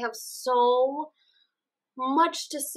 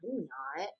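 mock dismissive scoff; 7 semitones lower, 8 dB quieter than usual. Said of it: "And he's like".

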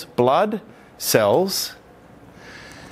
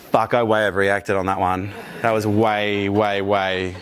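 lower, rueful admission; calmed-down regret; 8 semitones lower than usual. Retold or imitated. After calming down, admitting the overreaction in a lower, rueful voice. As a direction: sigh it out, lower, like you regret it. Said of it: "But then you calm down".

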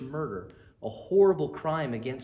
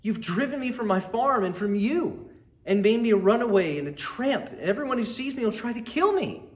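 loud, much higher desperate outcry; anguished cry; 11 semitones higher, 8 dB louder than usual. Acted. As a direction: wail it out in despair. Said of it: "cried Cain".

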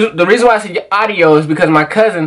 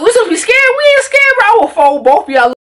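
high-pitched, mock-tough challenge; retold cocky defiance; faster than usual. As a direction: act out squaring up to two would-be fighters. Retells squaring up to would-be fighters.

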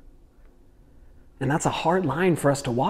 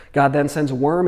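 usual speaking voice

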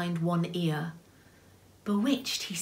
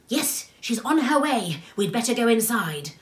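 raised, urgent little voice; high character voice; 6 semitones higher, 5 dB louder than usual. Acted. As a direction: warn them in a high voice. Character warns of the Lady.

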